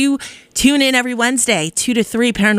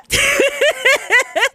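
high giggle. Real, not acted. DJ giggling high after a goofy riff. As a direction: burst of high-pitched laughter.

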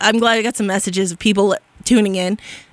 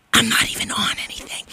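hushed, confiding whisper; whispered aside; 5 dB quieter than usual. Real, not acted. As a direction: whisper it like a secret confession. Aside, then repeated as catchphrase.

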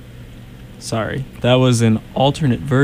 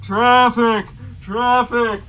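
mock announcer call; 9 semitones higher, much slower than usual. Mock traffic report bit.

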